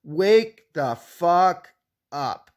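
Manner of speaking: each word dragged out very slowly; slow, emphatic. Shown as a demonstration. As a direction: spell out "wake the fuck up" word by word. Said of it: "Like, seriously".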